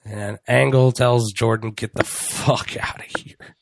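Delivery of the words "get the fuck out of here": hushed, whispered dismissal; mock menacing whisper. Host voicing the boss sending a wrestler away, stern mock whisper. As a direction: imitate a boss quietly ordering someone out.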